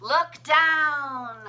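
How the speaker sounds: ordinary delivery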